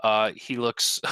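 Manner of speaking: ordinary talk, nothing marked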